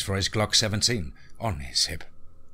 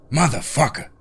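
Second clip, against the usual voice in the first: loud, raised exclamation; higher, forceful swearing; angry curse; 6 semitones higher, 5 dB louder than usual. Acted. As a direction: spit out the curse at an attacker. Character curses the attacking beast.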